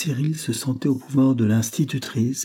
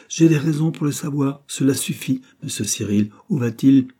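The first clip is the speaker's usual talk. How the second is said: higher, louder, impatient retort; stern interrogation; 5 semitones higher, 5 dB louder than usual. A narrator's reading. Governess cross-examining Cyril, stern and impatient. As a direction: cut off his evasions and demand an answer.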